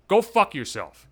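usual speaking voice

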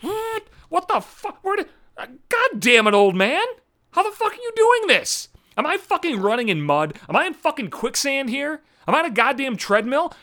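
very high, strained, sputtering disbelief; baffled protest in a squeaky pitch; flabbergasted outrage. Acted. Runner to the old man passing him, flabbergasted outrage. Acted shock at being overtaken.

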